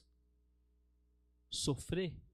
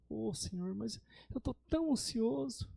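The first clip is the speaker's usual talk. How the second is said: higher, mildly plaintive pretend prayer; mock anxious plea; 4 semitones higher than usual. Preacher mimics anxious lapse right after praying, mock plea. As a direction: whine it lightly to God. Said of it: "e falo assim".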